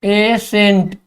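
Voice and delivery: "Accent" drawn out over both syllables; stretched-out, careful "accent"; slow dictation. Shown as a demonstration. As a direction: say "accent" slowly for students writing it down. Word repeated slowly for dictation.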